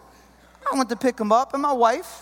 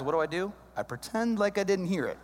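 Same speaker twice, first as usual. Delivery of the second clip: ordinary talk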